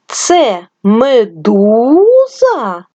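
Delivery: Це медуза? with ду stretched and held very slowly; stress on second syllable. Shown as a demonstration. Teacher shows stress in медуза, very slow. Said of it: "Визначимо наголос".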